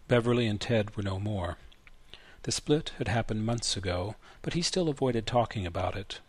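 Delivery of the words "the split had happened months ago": ordinary delivery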